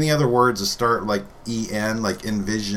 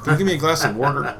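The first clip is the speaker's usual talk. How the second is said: quick island-dialect request with WAR-der; regional island accent; 4 semitones higher, faster than usual. Shown as a demonstration. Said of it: "I like they say water".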